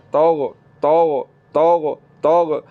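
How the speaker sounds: توغ slow, with a thick, heavy ghain; slow tajweed drill. Shown as a demonstration. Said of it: "غین کو موٹا کر کے پڑھنا ہے".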